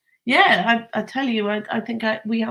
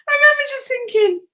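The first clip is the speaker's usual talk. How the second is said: words spilling out through laughter, high and quick; laughing; 6 dB louder, faster than usual. Real, not acted. Telling the listener about her son, laughing through the words. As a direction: talk fast while still laughing.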